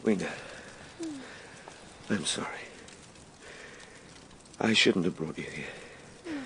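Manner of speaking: hushed, remorseful apology beside her; quiet apology. Acted. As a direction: murmur sorry to someone you failed. Apology to fainted Wiener.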